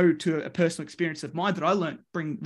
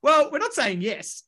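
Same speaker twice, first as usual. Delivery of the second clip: cheeky reply; 7 semitones higher than usual. Retold or imitated. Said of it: "we go".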